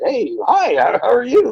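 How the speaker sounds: imitated eager, sociable hello; outgoing greeting. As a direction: greet a stranger like an over-friendly kid. Imitating outgoing brother greeting strangers.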